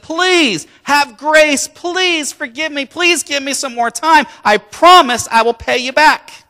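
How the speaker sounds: loud, high-pitched begging, slowly drawn out; desperate pleading. Acted. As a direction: beg for mercy, stretching each plea. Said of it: "the guy pleaded and pleaded".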